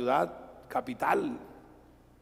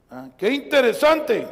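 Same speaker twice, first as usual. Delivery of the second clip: ordinary delivery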